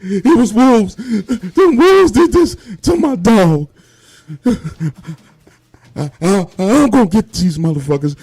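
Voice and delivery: crying man swears revenge over his dog; imitated sobbing. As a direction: blubber out the words through tears, stammering. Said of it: "Still crying and sobbing tears".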